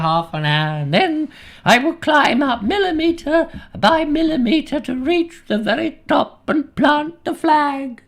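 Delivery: put-on eighty-three-year-old climber, high-pitched and straining; old mountain climber. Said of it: "That was my best rada acting".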